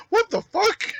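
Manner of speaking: high, loud startled shriek; screeched what-the-hell shock; shocked outburst. Retold or imitated. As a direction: yelp it in total shock. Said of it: "I was like".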